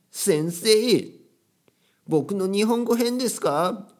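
high, loud, exaggerated cartoon voice; hammed-up anime character asking earnestly; anime-talking student. Student asking the teacher about Japanese, high cartoonish imitation. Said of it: "キャラクターの立ったね、".

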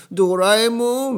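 very high, loud mock-tearful wail; Nobita. Boy crying to his robot cat, loud high mock wail. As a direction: bawl it out like a crying cartoon boy. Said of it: "だから常に泣いてるわけですね".

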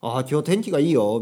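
bright discovery. Acted. Opening the morning curtains, announcing good weather, excited. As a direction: announce it as a happy little discovery. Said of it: "朝カーテン開けて、".

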